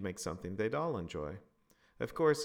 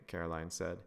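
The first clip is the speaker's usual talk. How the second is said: ordinary delivery, nothing marked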